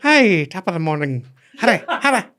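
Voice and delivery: high, hammed-up Irish lady voice; high comic Irish brogue that collapses; bad Irish accent. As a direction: try the Irish lady again and give up. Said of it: "I don't even know what that accent was".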